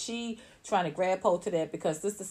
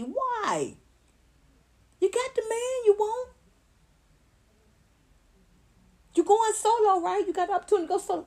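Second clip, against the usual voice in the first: mocking rant; 11 semitones higher than usual. Real, not acted. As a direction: taunt her, rising in scorn. Ranting directly at Latasha.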